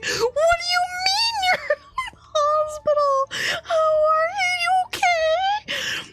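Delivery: very high, drawn-out wail of panic; hammed-up tearful shriek, stretching the words; freaking out. Retelling news of a friend in hospital, freaking out. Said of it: "I, like, freaked out".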